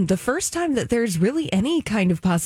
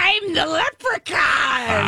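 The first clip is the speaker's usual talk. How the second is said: spooky voice; 6 semitones higher, much slower than usual. Pretend. Flashlight under chin.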